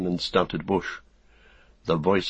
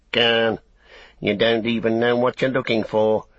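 orc tracker; 4 semitones higher, 4 dB louder than usual. Acted. Tracker keeps snarling.

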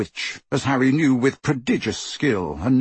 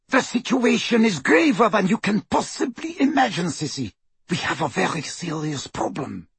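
high, agitated urgency, easing down; urgent; 7 semitones higher than usual. A narrator's reading. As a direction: press the danger on your sister. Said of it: "Bellatrix screamed".

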